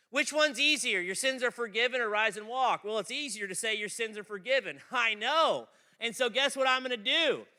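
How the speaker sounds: loud, high sermon delivery; booming pulpit preaching; full-force preaching. Acted. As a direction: drive the point home to the room.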